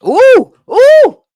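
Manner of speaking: high, loud excited ooh; excited hoots. Real, not acted. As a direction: hoot out loud like you can't believe it. Reacting to ropes course.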